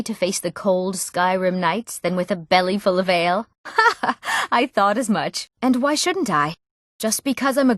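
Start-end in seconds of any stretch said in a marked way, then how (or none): 3.6-4.5 short high-pitched laugh; a quick, high ha-ha; acted laugh. Amused reply in game dialogue, laughing.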